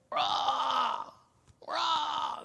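sharp high cawing cry, imitated; crow call. Shown as a demonstration. Preacher imitating a crow call for the congregation. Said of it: "I'm not a good crow, crow voice".

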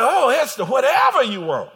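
loud, high exclamation as a delighted king; big preacher's shout in the king's role; sated, indulgent king. Acted. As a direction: belt out the king's generous offer. Voices the well-fed, indulgent king.

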